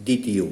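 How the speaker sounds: দ্বিতীয় said as ditiyo, no b sound; pronunciation demo. Shown as a demonstration. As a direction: drop the b-phala in দ্বিতীয়. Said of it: "উচ্চারণটা কী হবে?". (দ্বিতীয় উচ্চারণ দিতিয়ো, ব বাদ)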